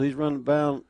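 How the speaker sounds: normal talking voice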